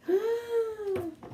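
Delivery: high sharp gasp; a startled intake of breath. A narrator's reading. Narrator as Sid climbs, high gasp.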